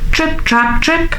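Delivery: high, light trip-trap; dainty high-pitched hoof sounds; light little hoofsteps. A narrator's reading. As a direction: patter it high like tiny hooves. Little goat's hooves on bridge.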